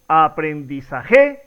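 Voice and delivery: "aprendizaje" stressed on the last syllable, JE; "aprendizaje" drawn out with a rising, accented final -je; misplaced final stress. Shown as a demonstration. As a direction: slowly push the end of "aprendizaje", aprendizaJÉ, questioning rise. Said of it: "La pronunciación correcta sería".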